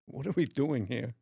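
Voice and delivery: put-on movie-star voice, puzzled; Christopher Walken. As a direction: ask it as the impersonated actor. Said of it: "you're gonna do Christopher Walken".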